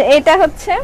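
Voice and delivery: usual speaking voice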